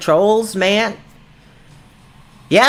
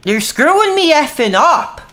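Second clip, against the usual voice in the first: higher, exasperated complaint to chat; annoyed outburst; 6 semitones higher than usual. Real, not acted. Streamer scolding chat for distracting him, exasperated. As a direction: snap at chat in real frustration.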